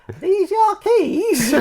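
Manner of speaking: put-on high, slow girl's voice. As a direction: quote a young girl, high and slow. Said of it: "was like".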